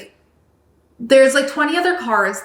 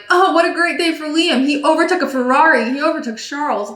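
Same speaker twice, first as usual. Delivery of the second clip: higher, mock-excited commentator; gushing commentator; 5 semitones higher than usual. Commentator praising Liam's overtake, higher mock-excited voice. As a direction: gush like a delighted commentator. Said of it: "the commentator was like".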